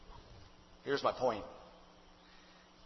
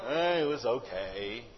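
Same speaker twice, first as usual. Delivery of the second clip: put-on unimpressed teen; 9 semitones lower, 5 dB quieter than usual. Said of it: "they respond with something like".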